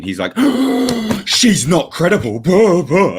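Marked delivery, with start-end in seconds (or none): 0.4-1.2 high, loud theatrical gasp; mock shocked gasp. Mocking Ray's reaction. 1.3-3.2 high, loud whiny mock voice; mocking impression. Mocking Ray flip-flopping.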